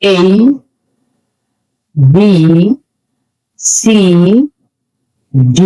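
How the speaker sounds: each English letter named separately and carefully; isolated, model letter names for learners; correct letter pronunciation. Shown as a demonstration. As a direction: recite the alphabet one letter at a time, with long pauses. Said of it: "Alphabet with correct pronunciation".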